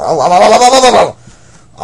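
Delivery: loud rising oh oh oh; outraged oh-oh-ohs. Acted. Customer sputtering loudly at the clerk's switch.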